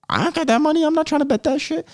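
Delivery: put-on squeaky-high voice, held on one note; high-pitched mock protest. Host voicing broke viewers refusing to bet, high-pitched mock protest. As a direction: jump way up high to act out refusing.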